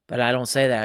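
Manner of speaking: ordinary delivery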